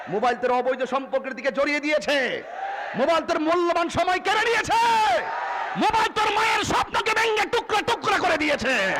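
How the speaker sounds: builds from loud to a high, full-force shout; rising fiery shout. Real, not acted. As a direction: hammer each line harder until it peaks. Preacher building to climax. (ক্রমশ চড়া গলায় চিৎকার)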